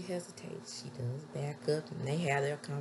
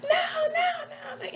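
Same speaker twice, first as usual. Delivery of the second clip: mock horrified cry; 6 dB louder than usual. She hears the threat to her dad, mock horrified cry. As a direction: cry out in imitated panic, way up high. Retold woman cries out in horror.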